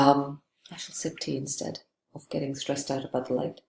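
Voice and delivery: ordinary talk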